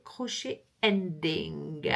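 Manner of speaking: English in a French accent, slow and low; low, drawn-out French-accented English. Real, not acted. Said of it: "Toujours avec mon, mon accent".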